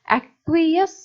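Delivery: "एक्वियस" high and emphatic, ek-kwi-yesss; pronunciation demo. Shown as a demonstration. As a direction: sound out "एक्वियस" loudly, stretching the final yes. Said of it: "ये तो इसका pronunciation है".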